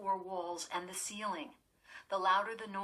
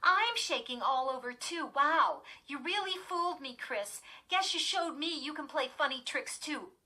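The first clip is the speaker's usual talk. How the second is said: mocking sarcasm; 8 semitones higher, 4 dB louder than usual. A narrator's reading. Sister mocking Chris.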